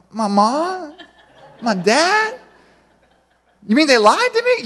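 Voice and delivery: high, wounded disbelief of a singer who has just learned the truth; naive contestant. Retold or imitated. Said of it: "And they're like".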